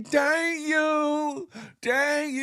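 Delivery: put-on high cute toddler thank-you; little kid voice. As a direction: copy a small child thanking. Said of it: "but you heard the little kids say".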